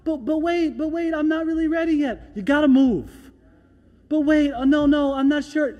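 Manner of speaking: a hint of whiny, hesitant pleading; hesitant people. The doubting people pleading with God, mildly whiny.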